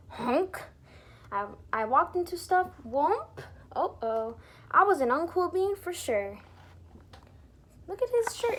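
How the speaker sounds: ordinary delivery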